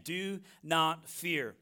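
each word slow and deliberate; slow, weighty scripture command; slow emphatic command. Acted. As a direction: lay down "Do not fear." slowly, word by word. Repeating the verse's command.

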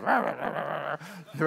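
rapid rah-rah-rah animal noise; imitated dog noises. Preacher mimicking the fallen dog, imitated rah-rah noises. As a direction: imitate the dog's rattling cry. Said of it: "falls on the ground and goes".

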